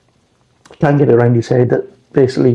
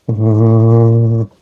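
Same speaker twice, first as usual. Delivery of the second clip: long, level monotone hum; monotone drone. Shown as a demonstration. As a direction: drone it on one unchanging note. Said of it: "some of them are quite monotone".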